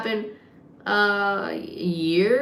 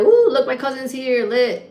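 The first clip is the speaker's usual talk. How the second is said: excited; 5 semitones higher, 5 dB louder than usual. Retold or imitated. Acts out delight at seeing cousin.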